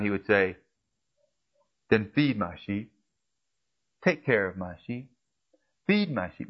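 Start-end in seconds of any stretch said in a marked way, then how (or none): 1.9-6.5 soft, low-voiced instruction, much softer; Jesus softly telling Peter to feed his sheep; gentle command. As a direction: hand the charge over quietly and kindly.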